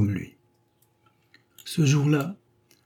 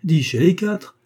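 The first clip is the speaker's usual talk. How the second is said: sharp retort; 7 semitones higher, 5 dB louder than usual. A narrator's reading. Husband retorts with a correction.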